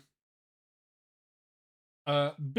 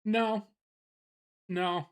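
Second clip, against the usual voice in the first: plain reading voice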